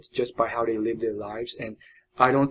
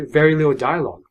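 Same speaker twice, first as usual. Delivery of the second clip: usual speaking voice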